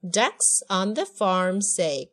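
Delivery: slow, measured read-along with punchy quacks; slow lyric modelling. Shown as a demonstration. Teacher modelling duck lyrics for a child, slow rhythmic delivery. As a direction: pace it out, popping each quack.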